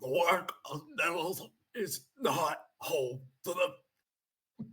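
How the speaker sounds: halting dying words, choked out; sputtering last breaths. Acted. Obark dying before the others, sputtering last breaths. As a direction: cough out your final words, breath by breath. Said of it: "coughs, sputters out his last couple of breaths".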